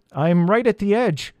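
high, mildly nervous call; calling, slightly trembling. A narrator's reading. Peter at the edge of the hole, nervous call. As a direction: call out with a touch of fear. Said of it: "called Peter, his voice trembling a little".

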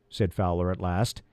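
usual speaking voice

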